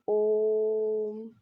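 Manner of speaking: slow, long-held om; drawn-out om. Shown as a demonstration. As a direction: stretch the vowel of ओम very long. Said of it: "बहुत ज़्यादा इसमें time लगता है".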